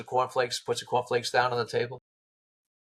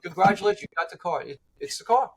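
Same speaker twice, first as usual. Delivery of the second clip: excited congratulations, higher voice; blown away; 6 semitones higher than usual. Retold or imitated. Caller congratulating Kevin on finding the car, blown away. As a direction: retell an amazed caller congratulating the finder. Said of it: "they were blown away".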